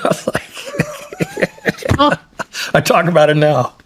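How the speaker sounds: talking through real laughter; chuckling as you remember it; laughing. Real, not acted. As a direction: laugh your way through the words. Laughs retelling escape.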